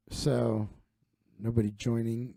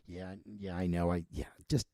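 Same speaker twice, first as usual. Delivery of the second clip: ordinary delivery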